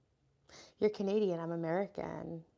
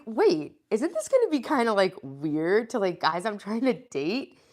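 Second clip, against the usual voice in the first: rising, louder voice full of worry; half-joking dream panic, louder and higher; alarmed second thoughts; 4 semitones higher, 11 dB louder than usual. Retold or imitated. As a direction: panic as the doubts hit you. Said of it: "I'm like".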